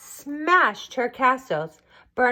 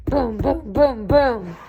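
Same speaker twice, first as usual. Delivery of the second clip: booming knock sounds, louder at the end; door knocking; 4 dB louder, slower than usual. A narrator's reading. Narrator knocking on the dragon's door, booming. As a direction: pound out each boom like a fist on wood. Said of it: "banged on the door".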